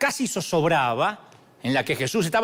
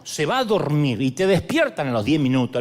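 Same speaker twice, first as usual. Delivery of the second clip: ordinary delivery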